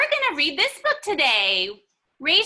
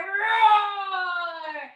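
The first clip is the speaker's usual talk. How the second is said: playful bear roar; 3 semitones higher than usual. Shown as a demonstration. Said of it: "roar like a brown bear".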